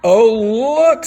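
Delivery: high and loud gasp; excited class. A narrator's reading. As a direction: point and gasp with delight. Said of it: "said the class".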